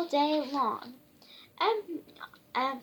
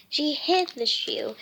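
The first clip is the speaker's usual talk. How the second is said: ordinary delivery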